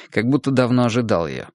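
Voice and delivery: plain reading voice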